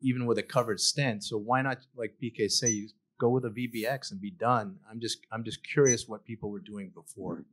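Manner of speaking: normal talking voice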